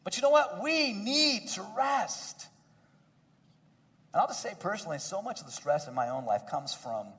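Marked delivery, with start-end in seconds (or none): 0.6-2.5 each word slowed and weighted; slow emphatic point. Acted. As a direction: drive it home slowly.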